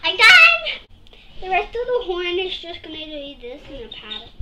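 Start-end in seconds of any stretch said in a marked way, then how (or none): 0.0-0.8 triumphant shout; excited shout. Real, not acted. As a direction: call it out proudly. Finishing the painting triumphantly.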